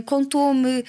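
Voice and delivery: ordinary talk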